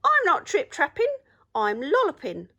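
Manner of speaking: high, loud, bouncy rabbit voice. A narrator's reading. Rabbit answering the troll from the bridge, high and bright. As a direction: chirp it up high like a cheeky rabbit.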